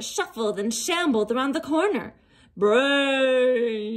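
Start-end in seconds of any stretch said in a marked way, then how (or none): 2.6-4.0 hungry zombie horde moan. Acted. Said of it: "they moaned".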